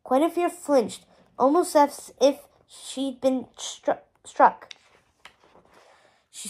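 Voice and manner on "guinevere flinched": plain reading voice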